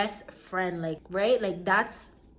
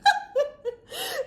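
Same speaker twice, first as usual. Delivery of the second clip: real burst of laughter. Real, not acted. Laughing at friend's reaction.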